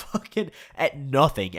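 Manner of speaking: usual speaking voice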